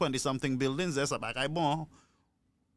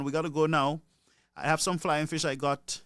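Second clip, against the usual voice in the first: ordinary talk, nothing marked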